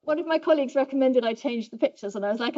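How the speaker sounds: usual speaking voice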